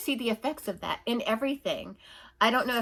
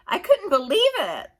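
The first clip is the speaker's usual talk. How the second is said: usual speaking voice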